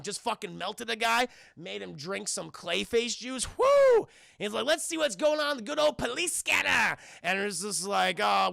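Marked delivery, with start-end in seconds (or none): 3.6-4.1 high, loud whoop; celebratory whoop. Retold or imitated. Henchman celebrates a finished job, loud high whoop. 4.6-7.0 high-pitched, rowdy enthusiasm; gleeful shout. Retold or imitated. Henchman turns to his police scanner, excited high shout.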